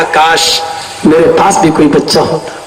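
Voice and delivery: put-on a father wishing for a child; wistful wish. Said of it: "میں نے کہا،". (بہت نیچی، حسرت بھری آواز)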